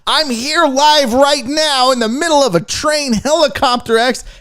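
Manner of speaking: high, pressing on-the-scene reporter; news reporter. Retold or imitated. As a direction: imitate an overeager live reporter. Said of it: "She would have to do the news".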